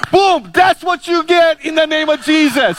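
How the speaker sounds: high, loud gloating shout; triumphant shout. Retold or imitated. Preacher gloating at the coughing heckler, loud shout. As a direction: crow over the heckler loudly. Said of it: "And I go".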